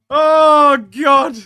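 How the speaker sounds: high, loud cry; overwhelmed shout. Real, not acted. Fan stunned by what's going on, overwhelmed shout. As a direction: let it burst out, very loud.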